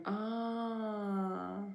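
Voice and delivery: ordinary delivery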